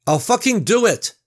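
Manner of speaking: high, loud outburst; furious shout. Acted. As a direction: shout to be heard at last. Gary finally shouts over them.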